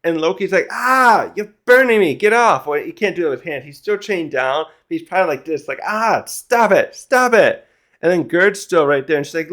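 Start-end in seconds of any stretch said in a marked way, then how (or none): 0.7-2.7 higher, louder mock cry of pain; acted yelp of someone getting burned; pained protest. Voices Loki being burned by food. 5.9-7.7 high mock yelp, drawn out then louder; hammed-up pained pleading; pained protest. Imagines burned Loki protesting.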